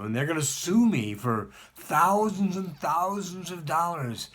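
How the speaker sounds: ordinary delivery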